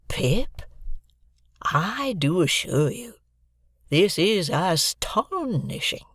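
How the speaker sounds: coming round but still amazed; slow wonder. A narrator's reading. Joe to Pip outside the gate, slow amazed wonder. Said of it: "prolonged his remark".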